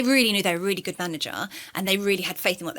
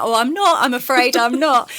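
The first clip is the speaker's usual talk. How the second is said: nan politely refusing; 5 semitones higher, 7 dB louder, slower than usual. Retold or imitated.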